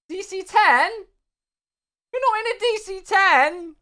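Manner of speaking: high, loud incredulous question; playful outraged double take; mock disbelief. Real, not acted. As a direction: act shocked at the chatter's plane. Reacting to chat's plane claim.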